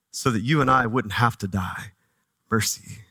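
ordinary talk, nothing marked

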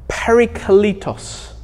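parakletos said slowly as PA-ra-kle-tos; Greek word said slowly. Shown as a demonstration. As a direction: sound out parakletos syllable by syllable for the congregation. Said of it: "have a go at saying it".

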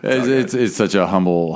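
usual speaking voice

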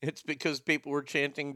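usual speaking voice

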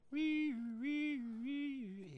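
high, repeated "wee" siren; imitated intercom alarm. A narrator's reading. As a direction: mimic an alarm with your voice. Intercom call sound in story.

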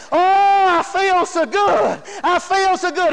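drawn-out, elated exclamation; acting out sudden delight at being healed; joyful relief. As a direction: exclaim it with a long swelling "oh". Healed woman wakes and exclaims.

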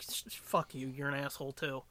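muttered comeback. Retold or imitated.

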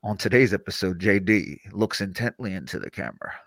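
low, measured announcer-style narration; narrator. Pretend. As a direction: drop the pitch and narrate the show.

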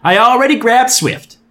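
high, eager bubbly voice. Acted. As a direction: chirp it out happily. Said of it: "a bubbly voice shouted".

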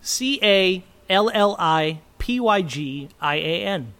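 slow letter-by-letter spelling. Shown as a demonstration. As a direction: spell each letter slowly and clearly. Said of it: "Can you spell it?".

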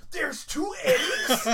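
high, loud, slow question broken by laughter; stretched-out high squeal of disbelief through laughter; laughing disbelief. Real, not acted. Retelling kid's amazement, laughing.

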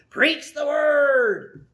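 loud, high, drawn-out command; proclaiming shout. Acted. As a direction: proclaim it slowly like a rousing charge. Paul's charge, proclaimed by the preacher.

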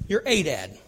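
low, soft, with "a" said as a full "ay"; stress on a. Shown as a demonstration. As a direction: lean quietly on "a". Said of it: "They don't go to just a dad".

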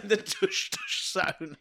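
soft, slow, drawn-out title voice; spooky show title through laughter; hushed, hammed-up intro; mock sci-fi announcer.

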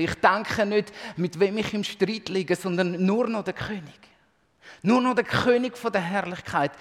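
preaching at full force. Acted. Preacher to congregation, exalting the King of Glory, loud and high. Preacher worships with rising intensity.

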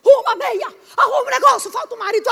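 loud, high nagging orders; mocking a nagging wife ordering her husband; bossy wife. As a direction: boss him around. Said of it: "ela fala".

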